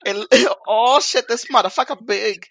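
loud laughing mock Vietnamese accent. Host in the cooking bit, laughing at the duck's size in a Vietnamese accent. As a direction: marvel at the duck in a Vietnamese accent. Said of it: "Vietnamese accent".

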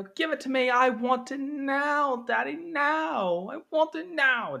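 high, slow whining demand; spoiled brat Veruca. Retold or imitated. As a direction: drag out each word like a bratty child. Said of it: "Veruca was just like".

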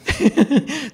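short amused laugh; real laugh. Real, not acted. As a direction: laugh at the dodge. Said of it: "चलो". (छोटी सी असली हँसी)

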